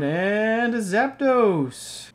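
slow, rising build to the last name; slow, high-pitched reveal; drawn-out card reveal. Real, not acted. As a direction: stretch it upward, then land on the name.

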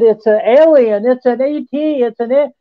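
excited insistence. Retold or imitated. Friend insists it is alien.

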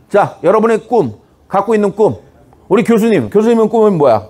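ordinary delivery

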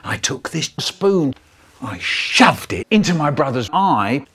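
ordinary delivery, nothing marked

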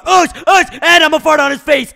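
high, loud excited yelling; rowdy boast. Pretend. Player boasting before the fight, rowdy yelling. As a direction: yell it like a rowdy taunt.

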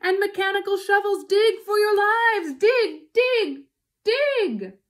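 high, full-force yelled orders; urgent shouting. A narrator's reading. Mister Fox ordering his family to dig, frantic shout. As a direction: shout as if lives depend on it. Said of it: "shouted Mister Fox".

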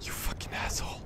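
gasped, whispered curse under the breath; tense whisper. Real, not acted. Gamer sneaking past a monster, whispering a curse. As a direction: hiss it so the monster won't hear.

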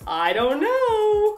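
high, slow drawn-out tease; coy singsong. Acted. Wondering who will copy the pink hair, slow high-pitched mock innocence. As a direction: stretch it out high, feigning innocence.

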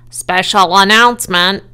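loud, pompous public announcement; pompous fairground loudspeaker voice. A narrator's reading. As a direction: boom it out like a self-important announcer. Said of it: "in a pompous voice".

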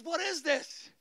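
high, wondering question; imitated amazement. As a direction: ask it as the astonished youth, retold. Retold youth amazed at seeing.

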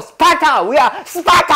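high, hammed-up shout; playful Spartan war cry; mock battle cry. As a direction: shout it like a drill chant, half laughing. Acts out brutal Spartan drilling.

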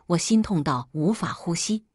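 ordinary delivery, nothing marked

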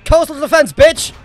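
triumphant shout. Real, not acted. Gloating after a kill.

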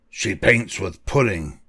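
ordinary delivery, nothing marked

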